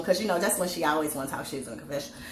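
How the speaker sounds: ordinary talk, nothing marked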